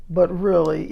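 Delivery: usual speaking voice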